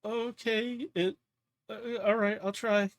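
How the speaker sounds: high, timid character voice; acted nervous, halting assent; hesitant agreement. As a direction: agree reluctantly in a small high voice. Scared Addie answers reluctantly.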